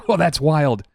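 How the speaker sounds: ordinary delivery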